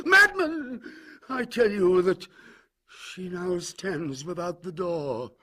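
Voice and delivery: high, loud scream of madman, then slow; frantic shriek. Acted. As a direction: scream it at him, then drag the last words out. Usher shrieks at the narrator.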